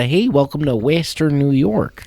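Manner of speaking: a hint of Buffalo twang; friendly local greeting in a light Buffalo accent. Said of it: "Buffalo's got a little bit of".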